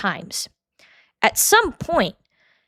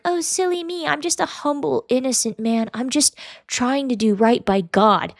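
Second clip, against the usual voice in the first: stupidly soft, aloof; 2 semitones higher than usual. Retold or imitated. Mocking a man who acts humble and innocent, soft aloof voice. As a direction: imitate a fake-humble man playing silly and harmless. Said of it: "talks in this stupidly soft, like, aloof voice".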